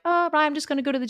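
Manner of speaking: tossed off fast as if it's no big deal; sheepish quick. Retold or imitated. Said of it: "now I would have been like".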